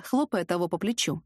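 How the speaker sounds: plain reading voice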